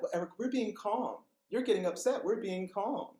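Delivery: high, condescendingly calm imitation; mock calm tone. Staff telling upset colleague they are calm, mock calm. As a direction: placate in a lifted, controlled voice. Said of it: "as long as we use this tone".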